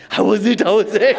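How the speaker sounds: mock eager curiosity; eager teasing question. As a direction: ask eagerly, teasing her. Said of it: "I asked".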